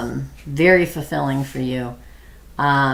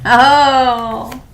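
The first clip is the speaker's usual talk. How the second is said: surprised oh; 8 semitones higher, 8 dB louder than usual. Real, not acted. Seeing the card.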